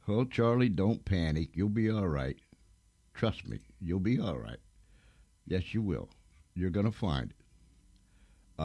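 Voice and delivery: calm a flustered helper quietly; easy, hushed encouragement that slows at the end; gentle reassurance. Real, not acted. Reassuring a flustered co-worker on air.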